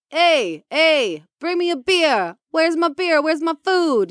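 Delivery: high, barking mock husband calling for beer; demanding husband. Wife mocking her husband demanding beer, barking. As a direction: play the lazy husband yelling for service. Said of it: "one of those husbands is like".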